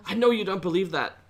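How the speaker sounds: rising into an angry, loud accusation; accusing. Acted. Kei confronting Edena about her hidden tears, angry build. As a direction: build to a loud, high cry of blame.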